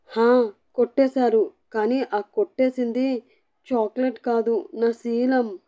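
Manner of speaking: a hint of tearful sniffles; sniffling. A narrator's reading. Wife tells her husband what was stolen, mild sniffling. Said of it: "ముక్క ఎగబీలుస్తూ".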